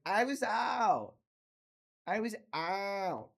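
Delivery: put-on slow, slurred drunk voice; lightly voiced drunk girlfriend; drunk slurring.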